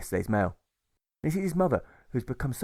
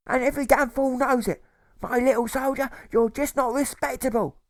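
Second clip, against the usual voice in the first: much higher and louder, scolding mother; mother berating her son, louder and louder, pitched high; hostile mother, rising to fury; 14 semitones higher, 5 dB louder than usual. A narrator's reading. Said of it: "his mother says with hostility".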